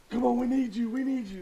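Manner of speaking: high, loud urging from teammates; pleading teammates. Acted. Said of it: "you got your teammates".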